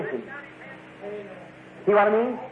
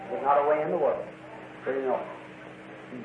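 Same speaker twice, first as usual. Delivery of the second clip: quiet; 7 semitones lower, 9 dB quieter than usual. Shown as a demonstration. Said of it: "repeated softly, fading".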